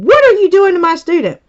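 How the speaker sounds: teacher confronting the lunchroom teacher, indignant; protective teacher. Retold or imitated. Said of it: "she's going".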